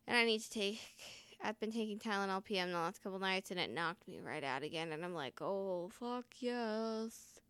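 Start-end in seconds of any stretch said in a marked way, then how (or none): 5.4-7.4 very slow, soft, drawn-out mock bliss; blissful relief. Retelling Tylenol knocking her out, blissful relief. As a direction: savour it, stretching every word softly. Acts out relief at sleep aid.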